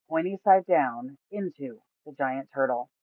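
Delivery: usual speaking voice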